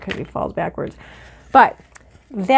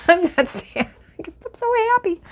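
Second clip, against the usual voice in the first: high, laughing, tearful and happy; high giggly happy tears; laughing through happy tears; 10 semitones higher than usual. Real, not acted. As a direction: laugh it out while teary-eyed. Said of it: "I'm tearing up".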